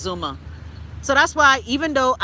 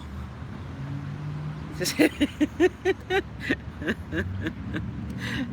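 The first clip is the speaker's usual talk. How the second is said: long quiet laugh; 5 semitones lower, 7 dB quieter than usual. Real, not acted. Long laugh after insult.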